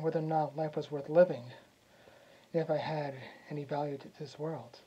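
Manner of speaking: soft, low and downcast; subdued confession. Acted. Prince confesses depression since dad passed away, subdued. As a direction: confide it quietly, voice sinking at the ends. Confiding depression after father's death.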